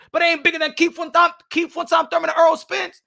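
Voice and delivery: loud, fast, pushing to a shout; passionate rant. Real, not acted. Streamer ranting to chat about the fight, building to a shout. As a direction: drive the point home with rising force.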